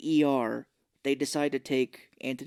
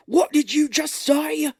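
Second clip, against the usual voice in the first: high, loud, slow English-Australian take; mock outrage in a wobbly Australian accent; botched English-Australian accent, outraged; 7 dB louder, slower than usual. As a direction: stretch it out in a bad Australian accent. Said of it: "My English Australian?".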